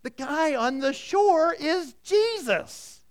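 high, slow, awed realization; John leaning over to tell Peter, high awed excitement; excited discovery. Retold or imitated. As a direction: let the wonder rise as you recognize him. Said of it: "he leans over to Peter and says".

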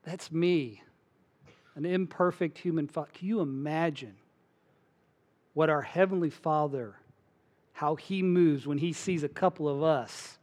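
normal talking voice

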